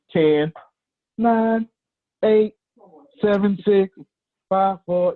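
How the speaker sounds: high, sustained countdown call; announcer countdown. Pretend. Host timing the screenshot, high drawn-out countdown. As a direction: count down on a high, held note.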